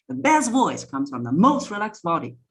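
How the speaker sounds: "best" and "most" jumping up high and loud; high, loud key words. Shown as a demonstration. Coach showing the mistake, high loud stress on "best" and "most". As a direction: startle them by punching "best" and "most" up in pitch. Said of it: "go high pitch or go loud on those words".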